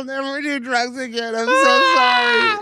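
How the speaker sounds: high, wailing, drawn-out sobbed apology; imitating a woman crying hysterically; mock sobbing. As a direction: blubber it out like a panicked crier. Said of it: "She starts crying".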